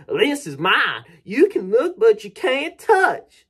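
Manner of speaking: high, loud mock bragging; boastful coworker. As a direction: taunt them like a show-off, stretching the last words. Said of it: "he whips it out being all like".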